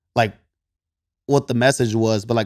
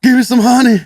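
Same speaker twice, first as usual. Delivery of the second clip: "Give me some honey" called out much higher and louder, like summoning the bear; calling out; 11 semitones higher, 8 dB louder than usual. Retold or imitated. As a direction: shout "Give me some honey" up high, half playful. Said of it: "this is my interpretation in my head".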